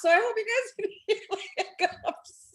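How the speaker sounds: high-pitched laughing speech; giggly. Real, not acted. Panelist apologizing to the group for her hiccups, giggly. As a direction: break into giggles while talking. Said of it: "like to laugh and get giggly".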